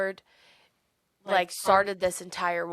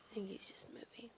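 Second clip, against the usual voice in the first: unvoiced hushed whisper; 2 semitones higher, 23 dB quieter than usual. Real, not acted. Whispering to her partner about the moving dog. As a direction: whisper so the dog stays calm.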